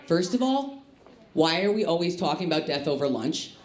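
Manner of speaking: ordinary delivery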